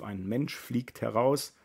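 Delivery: usual speaking voice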